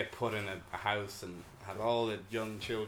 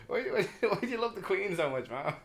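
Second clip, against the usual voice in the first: high, pleading question; incredulous; 11 semitones higher, 3 dB louder than usual. Retold or imitated. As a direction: ask her in disbelief. Retelling a question to his mom.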